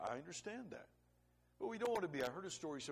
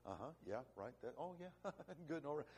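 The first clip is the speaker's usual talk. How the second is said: distracted listener; 6 dB quieter than usual. Preacher mimics a distracted parent, mumbling softly. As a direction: mumble along like you're not listening.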